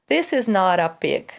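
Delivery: "not a" joined as "noɾ a" with a flapped T; linked consonant. Shown as a demonstration. Teacher demonstrating linking to students, joined sounds. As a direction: glide the T of "not" straight into "a". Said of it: "junta ali na pronúncia a consoante T com a próxima vogal".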